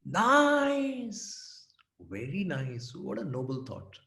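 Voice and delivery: dry mock praise; sarcastic. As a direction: praise it while meaning the opposite. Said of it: "full of sarcasm".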